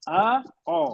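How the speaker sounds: آ open then او rounded, drawn; aa versus o. Shown as a demonstration. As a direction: repeat آ then او deliberately. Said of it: "آخر کی آواز سنیے".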